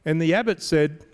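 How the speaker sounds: ordinary delivery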